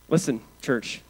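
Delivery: ordinary delivery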